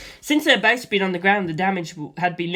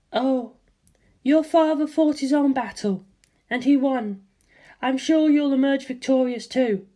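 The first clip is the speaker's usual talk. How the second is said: higher, tender mother; 7 semitones higher than usual. A narrator's reading. Mother encourages her son before his battle, tender. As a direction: reassure your son like a mother.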